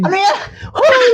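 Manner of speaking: laughing shout. Real, not acted. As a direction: yelp it with a laugh. Playful shout, laugh heard.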